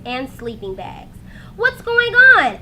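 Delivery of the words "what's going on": higher, louder, eager question; curious little brother. A narrator's reading. Little brother asking what's happening, eager and louder. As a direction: bounce in wanting to know. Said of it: "asked Arthur".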